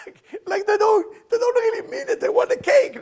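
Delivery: high, full-volume mock tantrum; hammed-up shouting. Preacher mocking kids who only want cake, high hammed-up yelling. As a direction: yell it in an exaggerated childish pitch. Acting out toddlers' insincere thanks.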